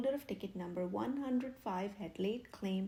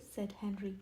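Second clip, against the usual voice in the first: usual speaking voice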